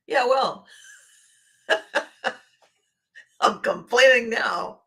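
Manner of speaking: slow, laughing comeback; laughing mock retort. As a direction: toss the retort back through a laugh. Acted quarrel, laughing.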